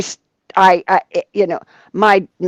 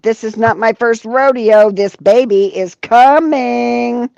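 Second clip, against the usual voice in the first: louder, higher, stretched-out ending; retold insistence; 4 semitones higher, 5 dB louder, slower than usual. Mother to doubting nurse, retold loud insistence. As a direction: insist emphatically, drawing out the last word.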